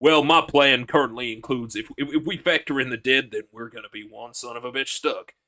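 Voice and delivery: put-on Southern American accent, deadpan recital; Eugene. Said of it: "It's Eugene's voice".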